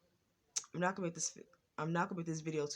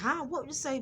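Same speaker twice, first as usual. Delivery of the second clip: high-pitched mock demand; nasty; 10 semitones higher, 4 dB louder than usual. Sister to a stuttering sibling, nasty imitation. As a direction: imitate a rude sister's questioning. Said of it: "She was like".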